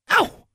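high, sudden "ow" yelp; pained yelp. A narrator's reading. Girl pecked by her raven, yelping. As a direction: yelp sharply high in pain. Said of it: "Alexandra yelped".

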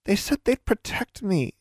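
put-on high, timid girl's voice; frightened confession. A narrator's reading. Tomo explains the society promised protection, timidly.

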